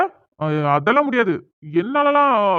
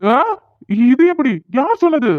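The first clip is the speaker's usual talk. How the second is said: shocked disbelief; 6 semitones higher than usual. Acted. Son shocked village knows.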